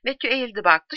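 usual speaking voice

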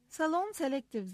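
name it with a touch of pride; proud. A narrator's reading. Bridget telling Mark her hairdryer model, proudly. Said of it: "dedim gururla".

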